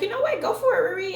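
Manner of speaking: high, bright burst of encouragement; psyched-up self-talk. Retold or imitated. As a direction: pump herself up, voice jumping way up. Telling herself to take the chance.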